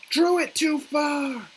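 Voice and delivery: high, slow dismayed cry; dismayed shout. Real, not acted. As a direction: yell out the mistake. Mistake in drawing zone.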